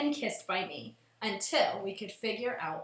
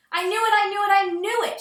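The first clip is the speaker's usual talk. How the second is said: loud, high, furious outburst; hopping mad; 10 semitones higher, 9 dB louder, faster than usual. A narrator's reading. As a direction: stamp it out in a fit of anger. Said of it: "She was hopping mad".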